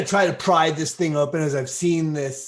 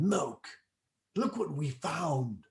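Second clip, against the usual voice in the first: hushed; 9 dB quieter, slower than usual. Acted. Character whispers to companion.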